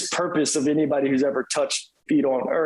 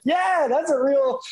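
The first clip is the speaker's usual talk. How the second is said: admiring exclaim; 12 semitones higher than usual. Retold or imitated. Admiring reaction to Jesus' walkout.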